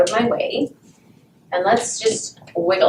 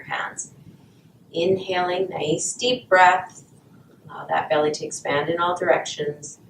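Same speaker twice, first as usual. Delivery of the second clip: guided meditation; 3 semitones lower than usual. Acted. Guided breathing at class start.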